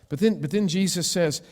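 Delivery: ordinary delivery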